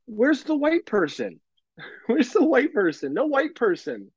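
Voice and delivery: put-on a small child puzzled at the crosswalk; toddler voice. Mother imitating her daughter in a high child voice. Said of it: "and she goes".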